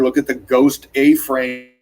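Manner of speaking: ordinary talk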